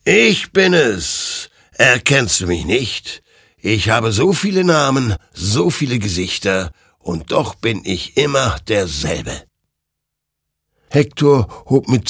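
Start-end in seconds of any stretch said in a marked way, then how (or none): 0.0-9.4 mighty spectral rider, proclaiming. Acted. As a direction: proclaim it like an all-powerful spirit.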